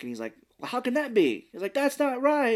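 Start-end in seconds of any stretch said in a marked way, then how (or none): 0.6-1.7 high-pitched mock outcry; retold disbelief. Swayze on the phone in the back room, mock disbelief. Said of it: "you can hear him in the back". 1.7-2.6 high-pitched mock outcry; retold disbelief. Swayze on the phone in the back room, mock disbelief. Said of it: "you can hear him in the back".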